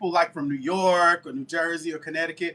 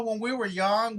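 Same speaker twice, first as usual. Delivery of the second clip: ordinary delivery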